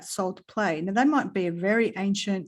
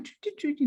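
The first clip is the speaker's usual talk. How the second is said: birdsong; 6 semitones higher, 4 dB quieter than usual. Retold or imitated. Imitating little wrens.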